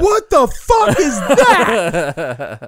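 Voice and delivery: drawn-out, loud mock yell. Son retells mom's reaction to a coffee stain, yelling. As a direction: imitate mom hollering it. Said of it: "she, like, yells it".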